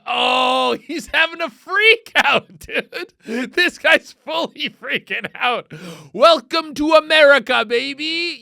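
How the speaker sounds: high, whooping jeer; rowdy, laughing ridicule; loud mockery. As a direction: crow over someone else's overreaction. Loudly mocking the man's freak-out.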